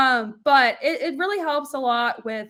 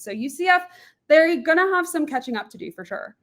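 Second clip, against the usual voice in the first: ordinary delivery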